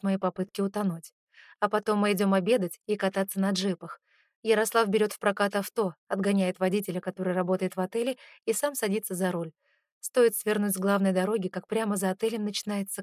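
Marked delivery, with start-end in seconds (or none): none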